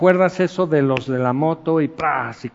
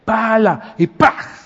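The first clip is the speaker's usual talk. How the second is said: louder, higher startled cry; startled exclamation; 5 semitones higher, 4 dB louder, slower than usual. Retold or imitated. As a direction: jump back as the gravel flies. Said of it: "Y se echó para atrás y dice". (grito sobresaltado imitado)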